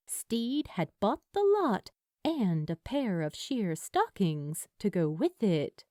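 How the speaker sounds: plain reading voice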